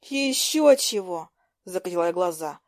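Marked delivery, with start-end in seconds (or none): 0.0-1.3 high, drawn-out scoff; scornful refusal. A narrator's reading. Heroine rejects Lucas's offer to undress, scornful. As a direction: brush him off, indignant.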